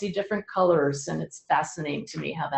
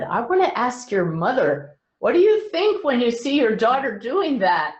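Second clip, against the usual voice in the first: louder, higher voiced challenge from the crowd; audience member; 5 semitones higher, 5 dB louder than usual. Audience member putting a question to the medium's mother, raised voice. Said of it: "one person in the audience said".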